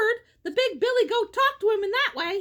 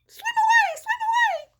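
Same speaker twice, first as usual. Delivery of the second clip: very high, loud little fish cry; high panicked fish voices; squeaky fish voice; 13 semitones higher, 4 dB louder than usual. Acted. Fish fleeing the troll.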